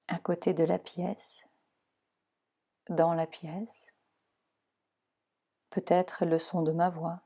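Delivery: calm, even meditation voice guiding relaxation; guided meditation. Acted. Leads Yoga Nidra relaxation.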